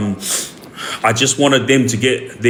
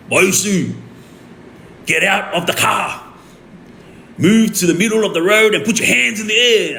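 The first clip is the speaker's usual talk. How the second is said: raised-pitch mock police command; retold police order; 7 semitones higher than usual. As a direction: imitate an officer calling orders over a speaker. Police ordering him over speaker.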